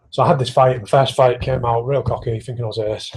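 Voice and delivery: ordinary talk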